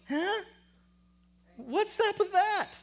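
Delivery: very high, incredulous squeal of protest; mock bafflement. Preacher voicing what we would say, hammed-up bafflement. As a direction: blurt it out in exaggerated disbelief. Acts out the congregation's puzzled reaction.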